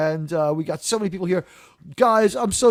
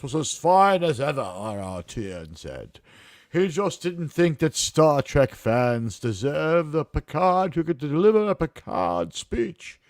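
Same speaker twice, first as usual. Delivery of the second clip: Picard voice; slower than usual. Retold or imitated. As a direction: deliver it like Captain Picard, slow and grave. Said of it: "How's that, by the way, for a Picard voice?".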